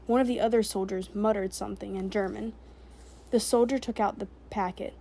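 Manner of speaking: plain reading voice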